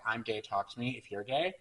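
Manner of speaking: normal talking voice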